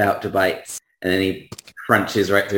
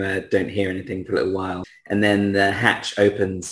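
ordinary talk

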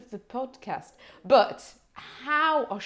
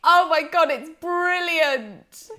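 higher, drawn-out real delight; delighted gasp; 5 semitones higher, 4 dB louder than usual. Real, not acted. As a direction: gush over a surprise gift, stretching the praise. Thrilled reaction to the gift badge.

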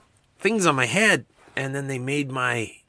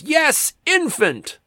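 higher deadpan alien reply; 14 semitones higher, much slower than usual. Acted. Red shirt alien answering the newcomer, higher and stiff. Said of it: "the red shirt one goes".

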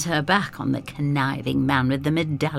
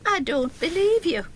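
higher, firm refusal; defiant girl. Acted. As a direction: stand up to him. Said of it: "says Effie".

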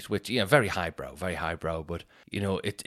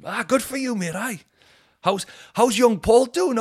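cheery mate; 13 semitones higher, 5 dB louder than usual. Retold or imitated.

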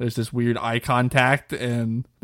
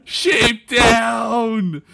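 high triumphant shout of the phrase; gleeful shout; 10 semitones higher, 5 dB louder than usual. Acted. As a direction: crow it out loud and high. Mocking chant at the loser.

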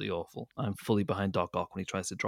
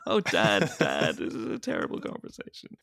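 pitched-up groan at dad; whiny son; 9 semitones higher than usual. Acted. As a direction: whine like an embarrassed kid.

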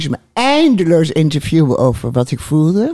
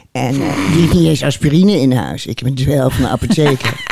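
ordinary delivery

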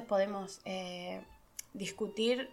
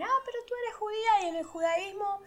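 pregunta curiosa imitada; 6 semitones higher than usual. Retold or imitated. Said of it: "me dicen".

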